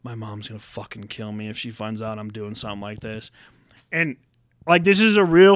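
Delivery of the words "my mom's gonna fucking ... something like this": low, very soft monotone mumble; quiet buddy. Retold or imitated. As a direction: mimic a timid kid worrying under his breath. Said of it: "the quiet one".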